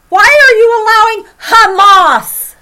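high, loud cry to God; desperate prophet crying out loud; anguished shout. Retold or imitated. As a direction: hurl the question at God. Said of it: "he went".

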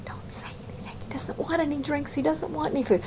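hushed servants' whisper; tiptoeing palace whisper. Retold or imitated. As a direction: whisper so the king won't hear. Servants tiptoeing around upset king.

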